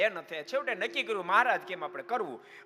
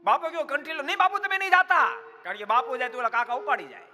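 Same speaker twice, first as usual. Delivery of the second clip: much higher, louder desperate begging; urgent desperate plea; 11 semitones higher, 7 dB louder than usual. Retold or imitated. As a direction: beg Bapu, frantic, not to leave. Jehlo begs urgently for the kanthi. (ખૂબ ઊંચા, મોટા અવાજે ઉતાવળી આજીજી)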